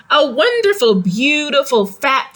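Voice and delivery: usual speaking voice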